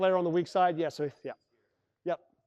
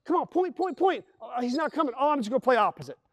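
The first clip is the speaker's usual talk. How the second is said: higher mock calls that give up; calling for point; 5 semitones higher than usual. Point guard calling the play, higher, then giving up. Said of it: "you're like".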